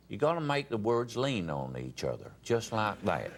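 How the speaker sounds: loose, run-together Texan speech; Texan accent. Shown as a demonstration. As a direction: let the words lean on each other in a Texas accent. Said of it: "When you speak Texan".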